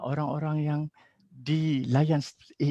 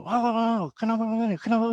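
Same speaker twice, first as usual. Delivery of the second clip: higher, scolding parent voice; 9 semitones higher than usual. Retold or imitated. Father scolding his child, higher and sharp. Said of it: "seorang bapa ataupun seorang bos yang guna".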